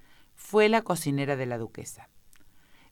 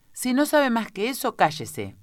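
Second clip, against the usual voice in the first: higher, firm order to be silent; stern command; 9 semitones higher, 5 dB louder than usual. A narrator's reading. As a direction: order them to hush, starting high and emphatic. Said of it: "ordenó el rey".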